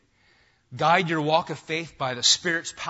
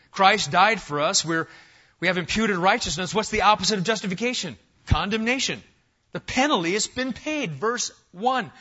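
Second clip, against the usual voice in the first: louder, building pulpit cadence; full-force preaching; 4 semitones higher, 6 dB louder than usual. Acted. Pastor rousing his church on assurance, fervent preaching. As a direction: preach it out to the back row.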